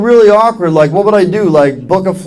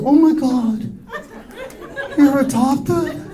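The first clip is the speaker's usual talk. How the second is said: high, soft, drawn-out mock concern; slow, soft, higher-pitched fake sympathy; slow pitying talk; 8 semitones higher, 7 dB quieter, slower than usual. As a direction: imitate someone gingerly breaking into slow talk. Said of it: "They start talking all slow to me".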